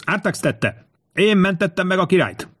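high-pitched narrated yell; shout. A narrator's reading. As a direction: protest your innocence to the crowd. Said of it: "üvöltötte Tinkomius".

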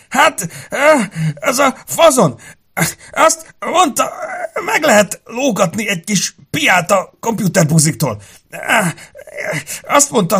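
high, loud, tearful stammering; agonized confession. A narrator's reading. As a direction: gasp out an explanation through pain. Wounded man forced to confess. (kínlódva, sírósan, magas hangon)

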